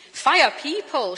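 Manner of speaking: put-on loud, slow, thick Belfast accent; Belfast accent shout. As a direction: call it out like a Belfast woman correcting a colleague. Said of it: "she shouted in her thick Belfast accent".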